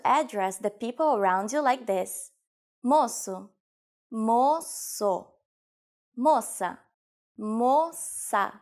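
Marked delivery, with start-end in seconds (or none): 4.1-5.3 drawn out in two syllables; slow demonstration. Shown as a demonstration. Teacher showing learners the word for boy, slowly. 7.4-8.5 two long, separated syllables; slow demonstration. Shown as a demonstration. Teacher showing learners the word for girl, slowly.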